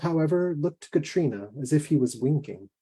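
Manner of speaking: usual speaking voice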